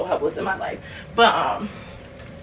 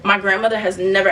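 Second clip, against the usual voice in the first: usual speaking voice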